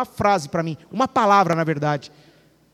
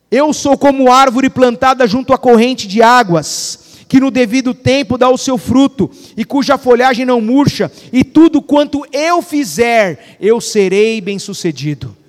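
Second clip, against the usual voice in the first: louder, slow, proclaiming the psalm; loud declaration; 5 dB louder than usual. Shown as a demonstration. Pastor showing how to start the day declaring, loud and slow. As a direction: declare it over yourself slowly. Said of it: "Aí olha só".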